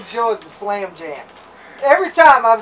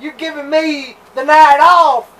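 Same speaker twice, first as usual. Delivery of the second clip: slow, loud growled promo line; wrestler promo voice; 6 semitones higher, 8 dB louder, slower than usual. Retold or imitated. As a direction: bark it like a heel wrestler. Sid quote, wrestling promo.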